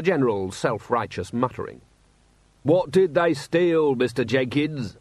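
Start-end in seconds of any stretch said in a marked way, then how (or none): 2.6-5.0 heckler. A narrator's reading. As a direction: needle him from the crowd. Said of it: "said a voice from the crowd".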